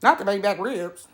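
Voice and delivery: low, off-voice repeat; lightly voiced lady; lady's voice again.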